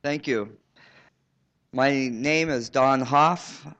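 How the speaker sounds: ordinary talk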